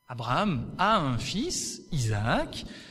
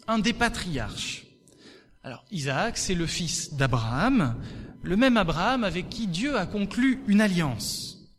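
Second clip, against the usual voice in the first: ordinary delivery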